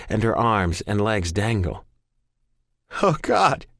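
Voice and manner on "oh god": sobbing. Acted. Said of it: "I sob".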